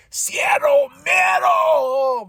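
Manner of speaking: high, loud, drawn-out wrestling catchphrase; excited shout. Acted. Wrestling fan hailing Penta's long-awaited arrival, excited shout.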